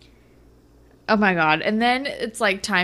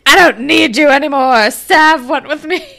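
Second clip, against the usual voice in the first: loud, high, snotty brush-off; snooty Jenna; 7 semitones higher, 10 dB louder than usual. Retold or imitated. Jenna telling off Casey, loud and snooty. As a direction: flaunt it in his face. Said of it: "Jenna's like".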